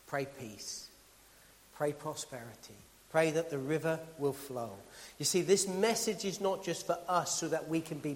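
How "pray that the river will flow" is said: ordinary talk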